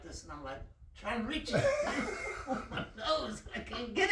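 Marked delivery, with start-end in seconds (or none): 1.6-2.4 squealing laugh up high; high burst of laughter. Real, not acted. Laughing at himself stuck in the MRI, high laugh. Laughing at his own story.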